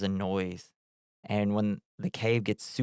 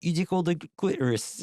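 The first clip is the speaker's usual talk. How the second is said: high mock Cockney, "clitoris" with a dropped t, sounding like "citrus"; Cockney accent; 8 semitones higher than usual. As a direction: imitate the British actress in Cockney, "tickle" stressed. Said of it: "I don't know why I'm giving that voice".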